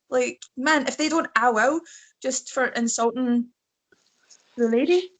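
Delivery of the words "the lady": accidentally sultry "the lady"; "the lady" with a slinky rising lilt; unintentionally seductive delivery. Real, not acted. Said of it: "I didn't mean to say the lady so seductively there".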